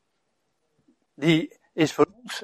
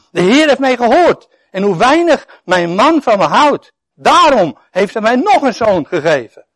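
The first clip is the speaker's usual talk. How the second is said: raised, louder plea; Lea's hurt hope, lifted high; Lea pleading; 5 semitones higher, 6 dB louder than usual. Acted. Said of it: "zei ze".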